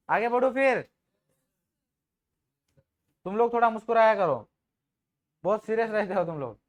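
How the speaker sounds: ordinary delivery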